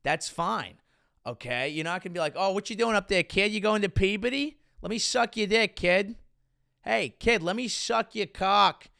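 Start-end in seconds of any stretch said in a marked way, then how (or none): 2.4-8.9 hammed-up Boston accent, higher pitched. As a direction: holler like a Boston local, pitched up. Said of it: "with a Boston accent".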